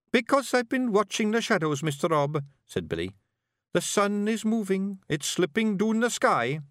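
put-on high boyish Scots, thinking hard; young Scottish pictsie bard. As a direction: ponder it like a nervous young bard.

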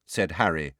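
ordinary delivery

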